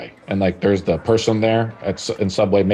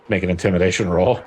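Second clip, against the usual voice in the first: ordinary delivery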